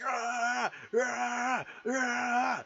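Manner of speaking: high mock screams in bursts; imitated screaming. Imitating the girl with the knife, repeated high screams. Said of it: "just screaming with a knife".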